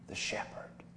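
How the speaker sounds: a hushed whisper of the secret. Acted. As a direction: whisper it like a reverent reveal. Sheep reveals the shepherd.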